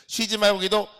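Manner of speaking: loud proclamation. Shown as a demonstration. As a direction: bark the command at your soul. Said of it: "선포했습니다". (높고 크게 외치는 선포)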